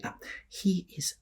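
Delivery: soft, unvoiced hushed aside; discreet whisper. Lister whispers discreetly about their friend. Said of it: "says discreetly".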